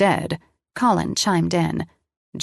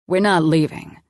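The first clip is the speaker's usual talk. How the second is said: owns the place. Acted. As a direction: state it like the boss of the room. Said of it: "He spoke as if he owned the place".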